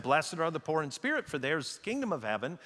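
brisk run-through of the quote, no pauses; quick, unweighted. Retold or imitated. Preacher quoting it as if someone else said it, quick and unweighted. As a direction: toss it off quickly, without weight.

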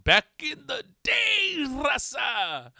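high, drawn-out holler; hollered catchphrase. Pretend. Comic hollering to his raza, long high shout. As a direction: belt it out, stretching the words way up high.

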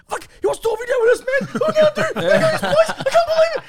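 high, loud, fast giddy squeal with laughter; mock fangirl excitement. Retelling how they fangirled, squealing excited. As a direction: ham up the starstruck panic.

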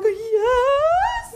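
high, near-crying yes; overwhelmed yes, voice breaking; thrilled yes. Retold or imitated. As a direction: squeak out an overjoyed yes. Accepting the front bar offer.

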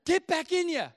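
acted-out dad's shout; dad ordering son back. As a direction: call the runaway back like a fed-up dad.

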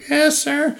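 put-on high, meek boyish reply; timid kid. Teen answering the famous magician, high and meek. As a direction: answer like a nervous fifteen-year-old.

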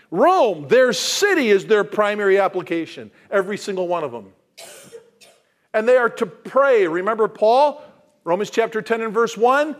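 loud, high, fervent preaching; full-force preaching. Acted. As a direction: preach it out to the whole congregation.

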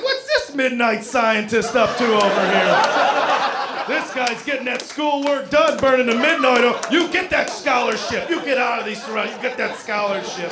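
loud, laughing mock encouragement; mock cheering. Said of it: "I was like".

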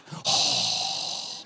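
long heavy exhale; acted wordless sigh of disapproval; silent-treatment sigh. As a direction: let out a drawn-out parental sigh. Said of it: "giving you the silent treatment".

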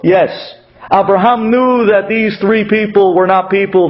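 ordinary talk

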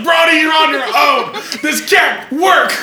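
high, loud panic; panicked shout. Retold or imitated. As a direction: yell like all is lost. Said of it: "you're just like".

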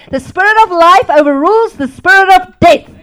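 loud, proclaiming preacher line; preaching. Acted. Preacher proclaiming to the congregation, loud declaration. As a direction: declare it to the whole church.

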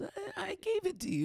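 very high, whiny imitation; Steve's whiny voice. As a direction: squeak it out like a whiny boyfriend. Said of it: "high-pitched, whiny voice that Steve does".

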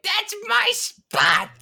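high, drawn-out goblin squawk; indignant goblin. Acted. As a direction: protest in a squeaky creature voice. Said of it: "the goblin that's on the ground, it's like".

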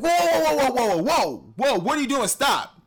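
high, loud startled cries of whoa; panicked family yelling at the kid to stop; alarmed yelling. Retold or imitated. Family in the garage yelling, startled, at the dancing boy.

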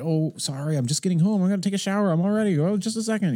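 high-pitched, hurried-sounding apology; apologetic excuses. Retold or imitated. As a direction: stall them with a string of high apologies. Acting out rushed excuses to wife.

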